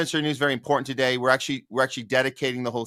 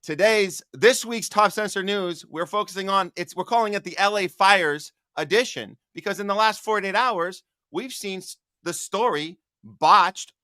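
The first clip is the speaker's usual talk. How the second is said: raised, punchy show-host delivery; news anchor read; 6 semitones higher, 3 dB louder than usual. Acted. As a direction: announce it like a TV news opener. Opens news segment as host.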